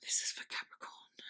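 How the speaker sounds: hushed, voiceless whisper; whispered invocation. Real, not acted. Tarot reader dedicating the cards to Capricorn, whispered. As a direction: whisper it quickly under the breath.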